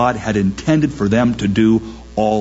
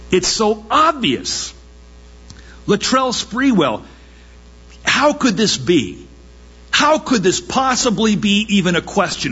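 higher, worked-up disbelief; mock indignant outrage; 6 semitones higher than usual. Preacher voicing indignant listeners, higher exasperated outrage. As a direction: play the outraged bystander who can't fathom it.

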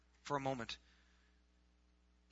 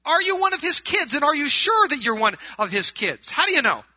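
high, loud challenging questions; full-force preaching; 13 semitones higher, 14 dB louder than usual. Acted. Preacher challenging his congregation on whether they are saved, full-force preaching.

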